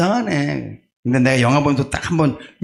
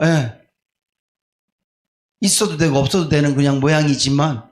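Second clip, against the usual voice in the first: ordinary delivery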